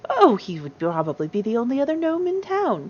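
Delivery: put-on lilting old lady voice with big swoops; fond gnome grandmother. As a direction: dote on the grandchildren. Grandmother describes son's family.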